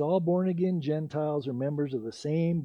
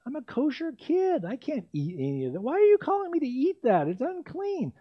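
much higher, whining protest; whiny Peter; 9 semitones higher than usual. Retold or imitated. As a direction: pitch way up like a fussy kid refusing food. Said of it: "In other words".

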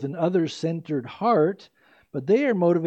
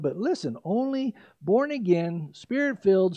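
ordinary talk

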